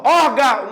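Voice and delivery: "órgão" with an open, un-nasal ending, "órgau"; som aberto, sem nasal. Shown as a demonstration. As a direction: let "órgão" out only through the mouth. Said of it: "nem sente o nariz". ("órgão" sem nasalizar, final aberto "gau")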